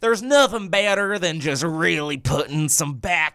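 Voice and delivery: hammed-up country Southern accent. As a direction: lay on a thick Southern twang. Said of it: "It's like a Southern".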